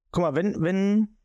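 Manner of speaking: ordinary talk, nothing marked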